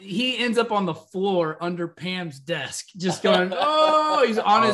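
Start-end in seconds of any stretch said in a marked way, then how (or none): 3.5-4.2 high pained oh; mock anguished groan. Michael lying under Pam's desk, a high mock groan.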